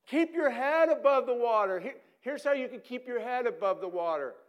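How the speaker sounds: loud, high, bossy calling from the bank; shouting instructions. Acted. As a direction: bark orders at the swimmer. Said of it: "shouting instructions to you".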